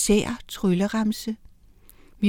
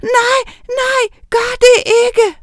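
very high, loud pleading shriek; acted high-pitched cry for help; terrified scream; 5 dB louder, slower than usual. A narrator's reading. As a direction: scream in panic for it to stop. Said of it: "Pludselig skreg hun".

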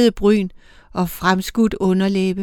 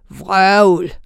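cutting dismissal; 3 dB louder than usual. A narrator's reading. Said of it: "afskar den ældre".

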